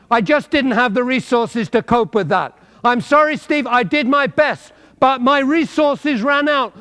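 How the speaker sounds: high and loud, pressing the point; preaching at full force. Acted. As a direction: drive it home to the congregation.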